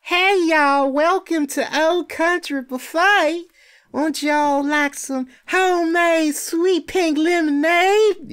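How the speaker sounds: put-on high, slow, sugary Southern belle accent; mock Southern Belle. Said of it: "this Southern Belle".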